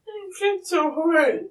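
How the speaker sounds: tearful, holding back sobs; crying. Real, not acted. Suspect tells the officer about the night, crying.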